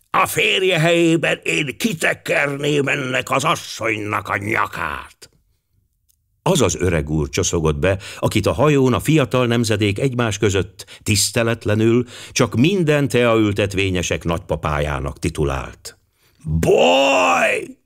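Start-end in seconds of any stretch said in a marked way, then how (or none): 0.1-5.2 put-on high, rasping old gentleman; hoarse, halting. A narrator's reading. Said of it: "Rekett, akadozó hang szólalt meg mögötte". 16.4-17.8 put-on high, rasping old man's call for service; shouted. A narrator's reading. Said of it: "kiáltotta".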